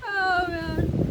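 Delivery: "Oh, man" through laughter; words still shaking with laughing. Real, not acted.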